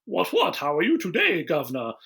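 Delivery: a hammed-up posh English accent; cheery put-on English accent, lightly done; mock English accent. Mocking English accent of humans.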